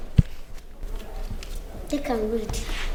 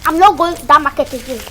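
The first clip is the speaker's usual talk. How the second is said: loud refusal; 9 dB louder than usual. Acted. Maid refuses errand, shouting at madam.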